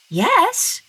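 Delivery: eager little yes; eager girl. A narrator's reading. Masha accepting the bat's help.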